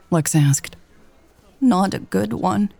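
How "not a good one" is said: put-on a croaky old woman's reply; croaking. A narrator's reading. Grieving mother telling Lex the occasion, croaking. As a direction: croak it out, voice catching. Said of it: "the woman croaked".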